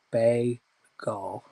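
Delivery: bagel broken in two with a gap, "bay ... gol"; sounding out bagel. Real, not acted. Host testing the word for viewers, bagel sounded out slowly. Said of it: "making me sound out words".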